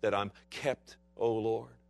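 lightly voiced thankful sinner murmuring; mild relieved prayer; relieved worshipper. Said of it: "standing there in front of that priest saying".

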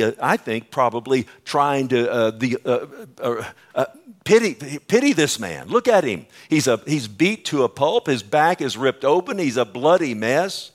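ordinary talk

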